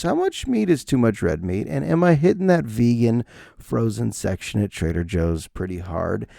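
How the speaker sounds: ordinary talk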